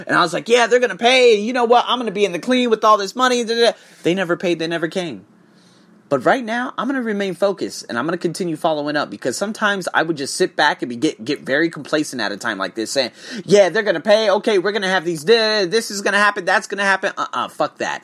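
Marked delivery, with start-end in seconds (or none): 0.5-3.8 high, loud, rushing with glee; mock his own cocky excitement; overexcited self. Retelling his own over-excitement. 13.5-17.1 complacent excitement. Retold or imitated. Mocking his own complacency.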